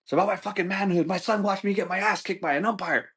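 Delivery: high-pitched hammed-up outrage; wounded indignant rant. Dad defending his manhood to wife, mock anguished. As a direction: overwrought husband, voice pushed way up.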